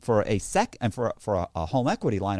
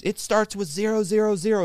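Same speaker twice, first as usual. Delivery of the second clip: higher, put-on accusing bank man; angry security man; 10 semitones higher, 4 dB louder than usual. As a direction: snap back that he's lying. Bank man voiced again.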